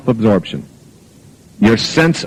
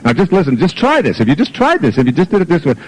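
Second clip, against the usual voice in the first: higher-pitched, insistent kid imitation; fast-talking daughter; 6 semitones higher than usual. Daughter pressing advice on her father, imitated fast-talking nag. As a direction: pester nonstop like a daughter who knows best. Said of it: "She talks really fast like this and goes on and on".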